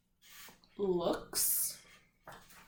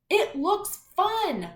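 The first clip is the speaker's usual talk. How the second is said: higher, louder and slow, "It looks fun" thrown out with excitement; emphatic exclamation; 9 semitones higher, 6 dB louder, slower than usual. Shown as a demonstration. Teacher showing the class an excited reading. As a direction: read it like an exclamation point. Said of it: "Putting more emphasis".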